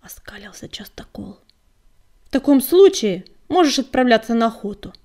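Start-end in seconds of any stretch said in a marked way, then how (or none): 2.2-4.9 sharp, loud angry retort. Acted. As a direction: snap back in anger. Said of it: "разозлился Огнегрив".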